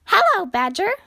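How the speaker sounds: high, loud, sing-out hello; cheery. A narrator's reading. Squirrel visits Grumpy Badger at his door, cheery. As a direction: greet him gladly, pitched well up. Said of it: "he said cheerily".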